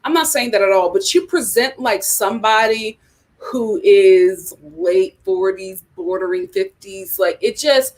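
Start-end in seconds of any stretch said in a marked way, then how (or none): none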